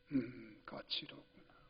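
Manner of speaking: put-on an old man's murmur, almost a whisper; hushed old father. Old father murmurs softly, half-whispered, to his son. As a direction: breathe the words out gently.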